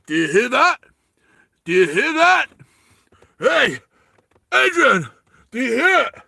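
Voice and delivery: high, loud calling out; Sylvester Stallone. Acted. Climber calling to Adrian in his tent, urgent impression shout. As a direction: shout up the mountain in short bursts. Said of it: "as Sylvester Stallone character starts climbing".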